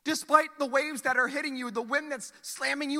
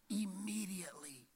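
whispered emphasis; 4 semitones lower, 13 dB quieter than usual. Acted. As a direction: let the word land in a hush. Whispered key word.

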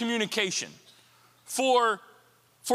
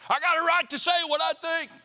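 defiant attitude; 10 semitones higher, 4 dB louder than usual. Acted. Preacher voicing defiant free-speech attitude.

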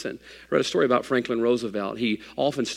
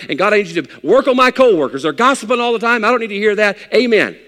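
mock demanding prayer; 6 semitones higher, 6 dB louder than usual. Mock prayer, rushing demands at God.

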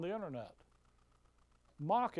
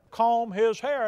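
loud, mocking the divine order; preacher lampooning a claimed word from God, loud; booming mock command; 3 semitones higher, 12 dB louder, slower than usual. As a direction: bellow it as a ridiculed voice of God. Mock-voicing God's command.

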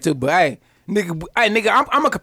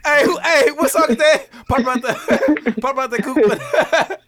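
laughing quote; 2 semitones higher, much slower than usual. Retold or imitated.

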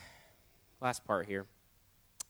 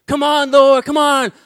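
high, loud pleading cry; pleading shout; 10 semitones higher, 13 dB louder, slower than usual. Retold or imitated. Young man in the aisle, shouting for prayer. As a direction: beg the Lord out loud to come. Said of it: "I'm like".

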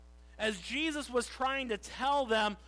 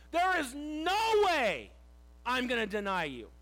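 emphatic protest; 5 semitones higher, 8 dB louder than usual. Acted. Peter insisting he will not deny Jesus, vehement protest. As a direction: protest at full force, then drop in pitch. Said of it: "vehemently there means to emphatically protest".

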